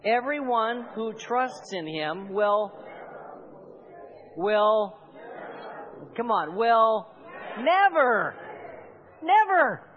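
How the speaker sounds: slow, loud, climbing to a near shout on never; said like you believe it. Shown as a demonstration. Pastor urging the congregation to believe the promise, rising shout. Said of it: "Say it like you believe it".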